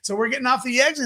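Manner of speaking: ordinary talk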